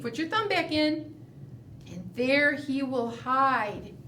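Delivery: ordinary talk, nothing marked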